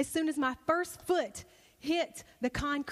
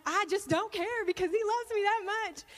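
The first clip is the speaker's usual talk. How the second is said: high, carefree elation; giddy happy; 8 semitones higher than usual. Retold or imitated. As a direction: toss it out high and overjoyed. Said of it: "I just got so happy".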